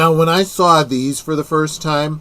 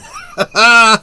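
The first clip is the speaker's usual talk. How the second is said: short, loud, higher-pitched laugh; real laugh; 8 semitones higher, 6 dB louder than usual. Real, not acted. Watching a film with friends, she doesn't screw around, loud laugh. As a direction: burst out in a brief loud laugh.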